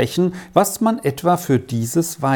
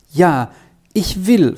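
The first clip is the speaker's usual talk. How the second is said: glad promise, lifting higher; joyful; 3 semitones higher, 3 dB louder than usual. A narrator's reading. Boy gives the pastor his promise, joyfully. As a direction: promise it with a touch of joy. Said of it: "versprach Erik freudig".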